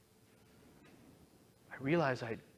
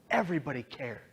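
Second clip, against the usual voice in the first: normal talking voice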